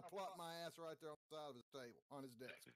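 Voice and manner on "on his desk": normal talking voice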